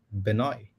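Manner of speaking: Benoit said as pen-OY, silent T; host sounds out Benoit as pen-oy, no final T; host's try at a name. Shown as a demonstration. Host guessing the guest's French name, Benoit as pen-oy. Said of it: "The T is silent".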